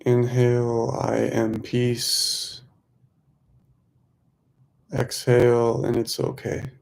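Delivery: measured mantra pacing, leaving room to breathe; guided meditation. Real, not acted. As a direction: lead them gently through the breath, stretching key words.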